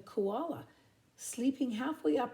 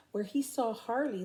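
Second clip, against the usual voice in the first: usual speaking voice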